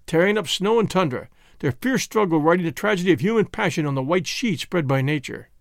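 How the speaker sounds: plain reading voice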